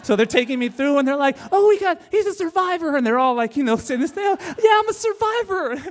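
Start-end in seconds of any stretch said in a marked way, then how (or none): 1.5-3.0 much higher and louder, eager announcement; excited hospital staff. Retold or imitated. Staff wheeling in the kid, excitedly announcing him. 4.2-5.8 mock proud echo. Kid mockingly echoing staff.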